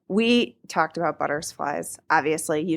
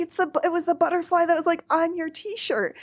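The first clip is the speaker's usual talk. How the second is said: excited; 9 semitones higher than usual. Retold or imitated. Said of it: "And she's like".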